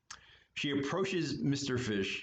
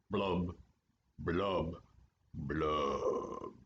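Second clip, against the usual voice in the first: sinking blub blub blub, each one lower; sad fish blubs; 6 semitones lower, much slower than usual. Acted. Gloomy fish sighing blub after his refrain. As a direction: let the blubs sink away sadly.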